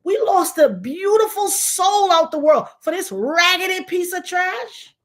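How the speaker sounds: imitated anguished wail, pitched high; retold crying. Father lamenting how [name] was treated, imitated crying. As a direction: cry out in grief as the overcome father, acted. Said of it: "he was crying".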